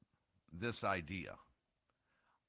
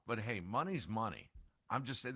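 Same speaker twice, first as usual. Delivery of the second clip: normal talking voice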